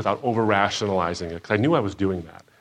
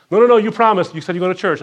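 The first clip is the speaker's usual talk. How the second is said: higher and louder, an emphatic no no no; mock insistent protest; 9 semitones higher, 7 dB louder, faster than usual. Friends reminding him he promised to go to church, loud mock insistence.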